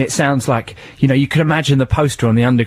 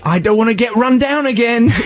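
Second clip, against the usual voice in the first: mock Hulk whine; 11 semitones higher than usual. Joining the Hulk bit.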